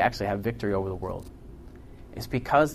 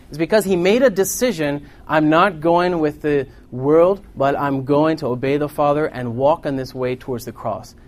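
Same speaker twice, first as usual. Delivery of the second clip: raised, louder sermon delivery; forceful preaching; 4 semitones higher, 8 dB louder than usual. Real, not acted. Preacher on Christ choosing the cross, forceful preaching. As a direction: preach it with urgent conviction.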